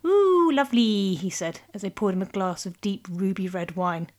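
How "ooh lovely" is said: slow, drawn-out forced delight; enthusiastic. Acted. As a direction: gush with nervous politeness. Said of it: "so agreed enthusiastically".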